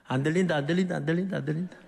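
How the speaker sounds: rapid repeated mock chant; imitated childish refusal to listen; blocking out teasing. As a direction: chant it over and over, ears plugged. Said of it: "귀 막고?".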